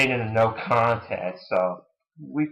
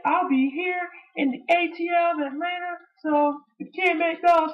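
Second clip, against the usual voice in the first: much higher, sarcastic mock voice; high sarcastic wrestler impression; sarcastic Punk mockery. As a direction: sneer it in a squeaky mock voice. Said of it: "he was saying".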